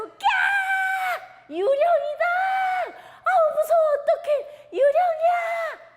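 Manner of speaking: scream of fright. Acted. Disciples spotting a figure on the water, screaming in fear. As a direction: scream as if you've seen a ghost. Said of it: "하고 소리를 질렀어요".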